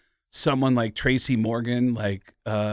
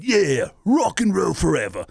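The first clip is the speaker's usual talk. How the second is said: higher, sneering rock-star snarl; 7 semitones higher than usual. Retold or imitated. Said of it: "[name] on the M T V Video Music Awards going".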